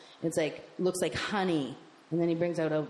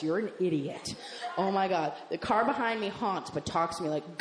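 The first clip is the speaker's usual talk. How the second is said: ordinary delivery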